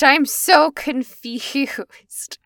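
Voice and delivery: laughing through the words, drawn out. Real, not acted. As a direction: giggle while saying it slowly. Laughing at the absurd theory.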